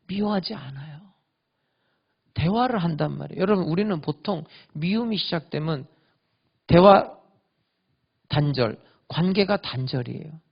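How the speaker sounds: ordinary talk, nothing marked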